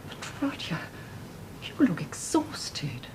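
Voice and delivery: slow, hushed concern; a tender whispered worry; worried whisper. Acted. Mother worried for son.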